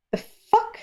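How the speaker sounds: sudden high squeak of disbelief; shocked. Real, not acted. Viewer reading a title, shocked high yelp. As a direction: caught off guard, yelp it out high.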